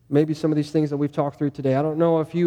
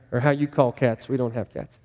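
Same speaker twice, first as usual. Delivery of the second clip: normal talking voice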